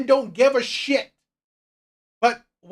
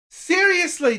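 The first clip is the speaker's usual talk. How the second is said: ordinary talk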